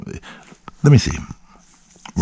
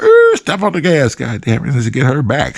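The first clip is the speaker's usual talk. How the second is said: high-pitched mock panic to the driver; urgent order; 8 semitones higher than usual. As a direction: hurry the driver along, voice raised high.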